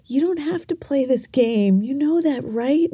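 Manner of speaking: lightly voiced uncle reassuring her; gentle uncle. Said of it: "he looks at me and he's like".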